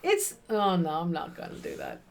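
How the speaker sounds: ordinary delivery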